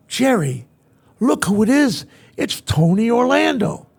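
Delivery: excited calling out. Retold or imitated. First Lady pointing out [name] to the President, excited. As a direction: call your husband over to see a star.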